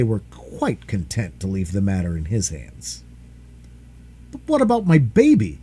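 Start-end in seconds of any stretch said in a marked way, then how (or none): 4.3-5.6 1 anxious plea. A narrator's reading. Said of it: "said John".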